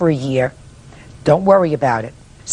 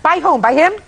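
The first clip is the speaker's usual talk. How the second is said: surprised question; 9 semitones higher than usual. Real, not acted. Judge reacts to harassment claim.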